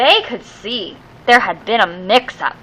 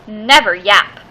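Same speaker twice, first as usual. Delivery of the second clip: ordinary delivery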